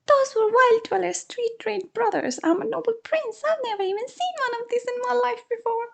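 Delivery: very high, pleading little creature voice; squeaky mongoose prince. Acted. Mongoose begging the man to save him, high and pleading. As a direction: beg like a tiny noble animal.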